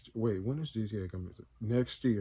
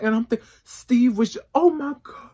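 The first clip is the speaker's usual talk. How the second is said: high, loud, breathless excitement; excited realisation; 11 semitones higher, 8 dB louder than usual. Real, not acted. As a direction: catch it mid-thought and gasp. Excited rant about the trailer.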